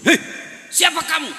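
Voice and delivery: high startled shout; startled cry. Acted. Husband startled by the jinn, high frightened shout. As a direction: jump back in fright.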